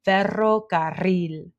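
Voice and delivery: "ferrocarril" with both double Rs trilled; "ferrocarril" in Spanish, tongue trilling on each rr; rolled Spanish R. Shown as a demonstration. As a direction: roll the Rs in "ferrocarril". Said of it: "words that have the R in them".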